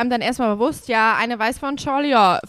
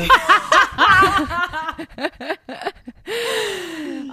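bursting laughter; 5 semitones higher than usual. Real, not acted. Group laughs at intro. (lautes Lachen)

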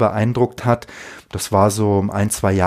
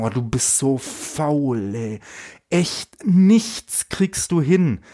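scornful inner voice; 2 semitones higher, slower than usual. Retold or imitated. Inner critic scolding the listener, slow and sneering. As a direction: drag it out like a nagging voice in your head. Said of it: "Wenn die kommen und sagen".

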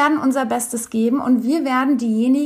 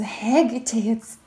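langsam geflüsterter verwunderter Gedanke; 2 semitones lower than usual. Retold or imitated. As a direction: den Gedanken leise für sich flüstern. Innerer Gedanke beim Erzählen. (erzählt ihren verwunderten Gedanken, langsam geflüstert)

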